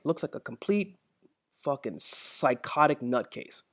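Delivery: usual speaking voice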